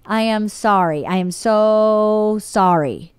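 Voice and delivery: slow, stretched mock apology; over-deliberate apology, word by word; drawn-out apology. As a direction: lay it on thick, holding SO very long. Retold token apology, drawn out.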